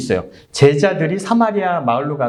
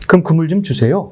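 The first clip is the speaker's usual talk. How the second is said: sarcastic. Retold or imitated. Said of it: "비꼬았는지 어쨌는지 이렇게".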